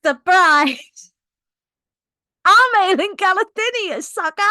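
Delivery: loud, high triumphant reveal; gleeful taunt. Retold or imitated. Queen revealing herself to the captain, loud gleeful taunt. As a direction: crow the big reveal at him. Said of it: "he's like".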